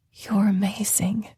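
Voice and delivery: hushed, breathy whisper; awed whispered compliment; breathed. Acted. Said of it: "I breathed".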